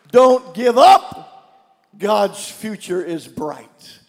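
each word drawn out slow; slow preacher's plea; slow emphatic exhortation. Real, not acted. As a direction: lean hard on every word. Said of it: "preacher exhorts".

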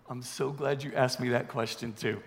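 low, soft tongue-in-cheek aside; mock-grateful aside. Preacher answering his own question, mock-grateful aside. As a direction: drop down low and quiet, as if answered.

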